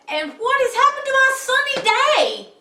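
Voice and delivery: loud, high, fast lament; dismayed wail. Acted. Jubal lamenting his failed wish, high wail. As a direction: wail it out in distress.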